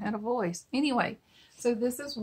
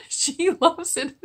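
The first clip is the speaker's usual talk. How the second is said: high, slow words broken by laughter; can barely get it out for laughing; 8 semitones higher, 6 dB louder, slower than usual. Real, not acted. Mom telling the cooks about her daughter, laughing. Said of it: "I'm laughing so hard".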